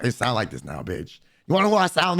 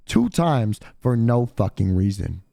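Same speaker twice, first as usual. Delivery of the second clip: normal talking voice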